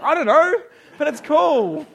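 put-on high, loud, laughing kid voice; cheerful kid. Son explaining his pointless hook, loud giggly glee.

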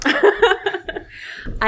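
real burst of high laughter; hearty laugh. Real, not acted. Author amused by question on parents' reaction, laughing. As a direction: break into a loud, amused laugh.

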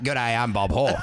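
put-on deep, broad Australian accent; mock Australian accent, pitched well down; Bob Hawke. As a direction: put on a low Aussie greeting. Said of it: "And then we land in Australia".

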